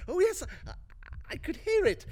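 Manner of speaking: high, eager, unhinged; manic excitement. Acted. Narrator insisting to the listener, manically excited. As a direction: insist on it with mad glee.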